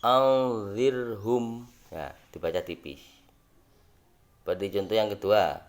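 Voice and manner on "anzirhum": anzirhum drawn out very slowly, thin ro; teacher recites the example to eighth graders; Quranic recitation. Shown as a demonstration. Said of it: "Contohnya bacaannya".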